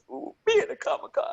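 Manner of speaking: high, fast mock whine; hammed-up high-pitched yearning, rushed; mock pleading. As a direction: squeak out the longing in a very high voice. Mock pleading to attend Comic-Con.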